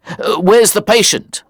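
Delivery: high, anxious inquiry; urgent question. A narrator's reading. Doctor arriving to see the sick woman, urgent.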